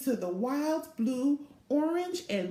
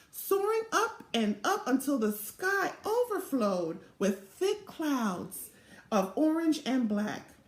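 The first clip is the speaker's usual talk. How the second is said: ordinary delivery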